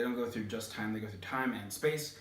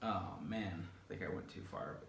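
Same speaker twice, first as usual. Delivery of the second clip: sheepish whisper; 4 semitones lower, 11 dB quieter than usual. Real, not acted. Embarrassed aside after story.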